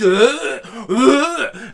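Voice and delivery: high, loud strangled choke; mock choking grunts; choking groan. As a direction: gag on the hot sauce. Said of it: "he's just".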